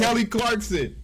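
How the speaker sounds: high, loud, showy reveal; put-on announcer cheer. Host revealing the story's subject, showy high voice. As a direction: shout the name up high like a hyped announcer.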